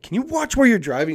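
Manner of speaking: high-pitched, exasperated question; retold scolding. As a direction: imitate telling off a careless driver. Retold snapping at texting driver.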